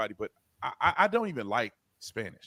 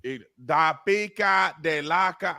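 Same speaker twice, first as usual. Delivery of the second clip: mock Spanish; 4 semitones higher, 7 dB louder, slower than usual. Attempts mocking Spanish.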